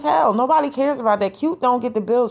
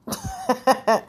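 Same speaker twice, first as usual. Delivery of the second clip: high giggle. Real, not acted. Laughing at her old photos.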